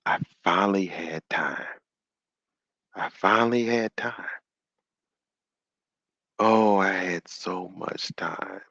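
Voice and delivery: soft, broken-hearted lament; mock weeping. Man with broken glasses, softly half-weeping lament. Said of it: "he starts saying".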